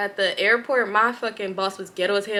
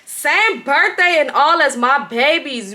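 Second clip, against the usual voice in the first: stunned; 4 semitones higher, 5 dB louder, slower than usual. Real, not acted. Still shocked while reading.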